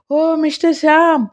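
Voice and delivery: put-on high, alarmed call of an old lady; a high panicked call across the house; landlady calling out. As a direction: call him in from the next room. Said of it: "అని పిలుపు వినపడింది".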